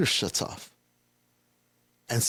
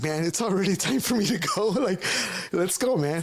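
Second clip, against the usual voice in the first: higher, chuckling through the words; laughing brother; 8 semitones higher than usual. Retold or imitated. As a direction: brother laughing while urging him to leave. Brother laughing, eager to leave.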